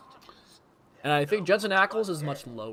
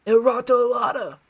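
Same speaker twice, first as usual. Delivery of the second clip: much higher, louder gangster voice; Batman Penguin impression; 9 semitones higher, 7 dB louder than usual. Gamer imitating The Batman's Penguin, high and loud. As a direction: cackle it like a gleeful mobster. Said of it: "the Penguin from The Batman is like".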